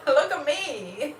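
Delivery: high, drawn-out plea for notice; playful slow cry for attention; calling out to God. Retold or imitated. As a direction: beg to be seen, half laughing. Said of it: "where I'm like".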